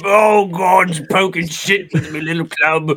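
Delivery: put-on terrible British accent. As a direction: ham up a bad British policeman accent. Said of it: "apologize for our terrible accent".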